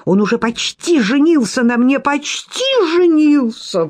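mock her claim with contempt. A narrator's reading. Sofya mocks Lidia's marriage hopes, contemptuous. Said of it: "пренебрежительно добавила".

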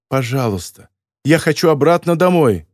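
ordinary delivery

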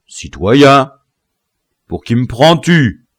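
crying out in anger; angry. A narrator's reading. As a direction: explode at being addressed that way. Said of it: "s'écria Biassou avec colère".

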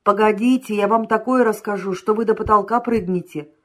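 ordinary delivery, nothing marked

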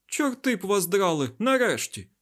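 high, loud exclamation; exasperated outburst; agitated yelling. A narrator's reading. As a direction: burst out loudly, high-pitched. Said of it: "горлали одне на одного".

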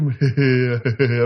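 low, teasing "hề hề" chuckle; imitated ghostly chuckle. Storyteller imitating the dead uncle teasing his aunt, mock chuckle. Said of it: "Ông cười hề hề như vậy".

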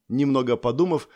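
ordinary delivery, nothing marked

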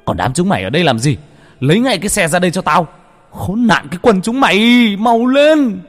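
yelling and cursing. A narrator's reading. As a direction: bawl out the servants, building to a scream. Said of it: "Cha vẫn la hét ở giữa nhà". (la hét mắng chửi)

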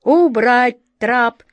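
loud, slow, ruthless order; merciless command. A narrator's reading. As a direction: command it slowly with no pity. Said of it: "безжалостно скомандовала атаман Джина".

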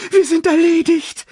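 very high, loud wail; despairing cry at the top of your range; panicked gasp. A narrator's reading. Sasu facing the hyenas, panicked. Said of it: "keuchte Sasu".

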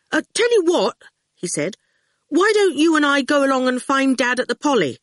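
a hint of a nervous boy; young boy voice; lightly voiced boy. Boy Howard voiced in story.

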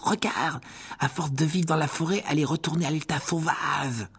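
delighted. A narrator's reading. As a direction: show it off with delight. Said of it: "dit Ron d'un air ravi". (ravi, plus aigu)

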